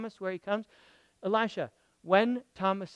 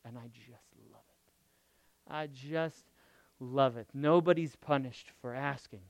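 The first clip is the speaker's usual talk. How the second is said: usual speaking voice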